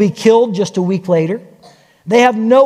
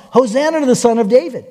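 ordinary delivery

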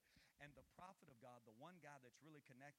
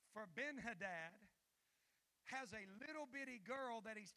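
preaching; 9 semitones higher, 12 dB louder than usual. Acted. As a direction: lean hard into the telling.

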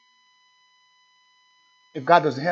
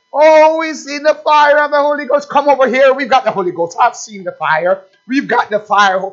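loud, higher hammed-up church hype; mock excited boast; 8 semitones higher, 7 dB louder than usual. Preacher parodying a church calling people over, hammed-up excited. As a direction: call out like a showman who has the fire.

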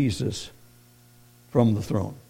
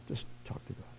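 whisper; 23 dB quieter, faster than usual. Real, not acted. Quiet echo of prayer.

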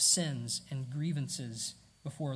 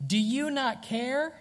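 provocative question; 7 semitones higher, 3 dB louder, slower than usual. Retold or imitated. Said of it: "They're provocative".

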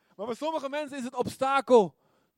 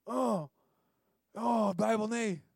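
reluctant whine; 5 dB quieter, slower than usual. Retold or imitated. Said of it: "ik denk".

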